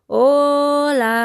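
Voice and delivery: high, loud call to the mountains; calling loudly. Acted. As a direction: call out as loud as you can. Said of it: "Le llamó tan alto como pudo".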